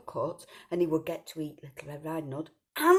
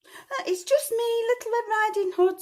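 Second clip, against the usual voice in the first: put-on much higher, louder sweet child; little girl voice; 8 dB louder than usual. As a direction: answer sweetly like a small girl.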